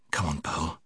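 hushed, low, breathless voice. A narrator's reading. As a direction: say it softly, short of breath. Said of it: "he said in a breathless voice".

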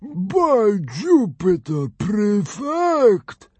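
slow high mouthful drawl; fat Roman drawl. A narrator's reading. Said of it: "drawled the fat Roman through a big helping".

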